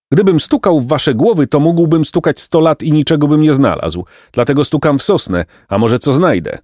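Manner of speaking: high-pitched bird voice, retorting; testy woodpecker. Acted. Woodpecker rebuking the boys, high testy retort.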